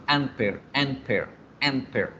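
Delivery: "an pair" with a cut at the n; broken choppy demo. Shown as a demonstration. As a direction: stop at the n in "an pair". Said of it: "la palabra se me rompe". ("an pair" con corte en la n)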